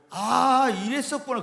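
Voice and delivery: usual speaking voice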